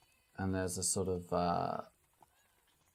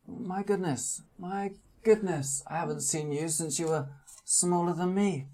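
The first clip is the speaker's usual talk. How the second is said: put-on small old man voice; 9 semitones higher than usual. GM voices Charles Adams.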